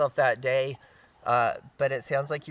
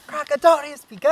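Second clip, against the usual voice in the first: high loud shout of the spell; mock spell shout; 13 semitones higher, 11 dB louder than usual. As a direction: blast the spell at Bigfoot. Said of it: "Tell him".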